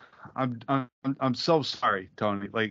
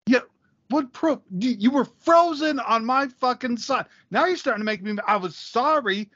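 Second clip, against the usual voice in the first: angry; 8 semitones higher than usual. Real, not acted. Arguing over frozen video call.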